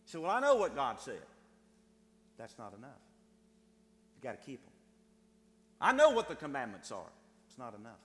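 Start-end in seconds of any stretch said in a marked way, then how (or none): 0.2-1.2 louder, insistent objection; protesting believer. Acted. Churchgoer answering the preacher that knowing is enough, loud protest. 5.8-7.1 loud, insistent comeback; protesting believer. Acted. Churchgoer defending himself to the preacher, loud insistence.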